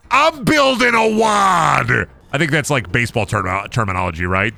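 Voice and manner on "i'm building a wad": talking through a wad of gum; mouth full. Real, not acted. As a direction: chew while you talk. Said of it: "Mouth completely full of Big League Chew".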